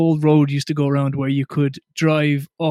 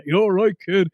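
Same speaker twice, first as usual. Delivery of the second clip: put-on a light half Irish, half English accent, a bit higher; 4 semitones higher than usual. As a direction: Jack Charlton's mixed Irish-English accent, lightly done. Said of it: "that real sort of half Irish, half English type".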